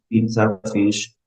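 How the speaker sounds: normal talking voice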